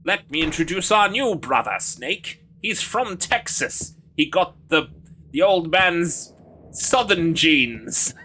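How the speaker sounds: put-on Texan accent, drawn out slowly; Southern Snake. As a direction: introduce him in a slow Southern action-hero drawl. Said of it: "Southern Snake".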